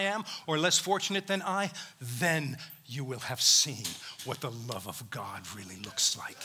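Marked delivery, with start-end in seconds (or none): 2.0-6.4 breathy hushed whisper, lower. Real, not acted. Preacher challenging his congregation, hushed whisper. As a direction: drop to a whisper.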